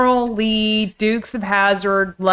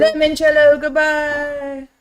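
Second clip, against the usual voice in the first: mock farewell; 6 semitones higher than usual. Bidding the limoncello goodbye, high mock call.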